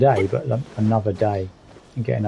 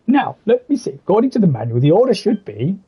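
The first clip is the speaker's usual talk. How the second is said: NPC voice reading; 8 semitones higher than usual. Acted. Voicing the NPC reading manual.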